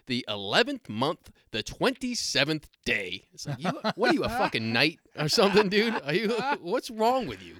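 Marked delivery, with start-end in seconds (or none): 0.0-3.3 slow, emphatic, annoyed repeat; mock pissed-off. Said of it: "So now he's pissed that he has to repeat him". 3.6-7.5 loud, high-pitched incredulous rant; mock-berate him in loud disbelief; comic exasperation. Acted exasperated retort to customer.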